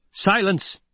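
high, sudden commanding cry; roared. A narrator's reading. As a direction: roar one word to cut someone off. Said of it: "Paul roared".

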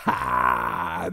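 strange, unhinged laugh; bizarre burst of laughter. A narrator's reading. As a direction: break into a weird cackle. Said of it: "acayip bir kahkaha attı". (acayip bir kahkaha)

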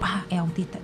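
low, very soft imitation of backing off; retold sheepish retreat. Stranger gives up and leaves, sheepish. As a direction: drop right down, deflated.

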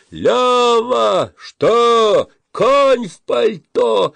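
very high, loud, drawn-out shouts; gleeful yelling. A narrator's reading. Boys trade silly calls under the arch, yelling. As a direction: bellow each phrase like testing an echo.

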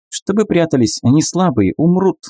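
high squeaky creature; piping little animal voice; lemur voice. Acted. Lemur explains.

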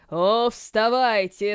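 drawn out, slow summons; slow drawn-out call. Acted. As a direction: stretch out вставайте slowly. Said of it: "словно издалека, послышался голос".

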